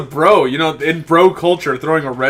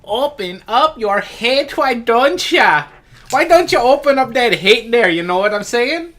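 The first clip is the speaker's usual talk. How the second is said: put-on Minnesotan accent; 8 semitones higher, 4 dB louder than usual. Mocking a friend in a Fargo accent, hammed-up. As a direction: stretch it out like a Fargo local. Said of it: "Canadian slash Minnesotan".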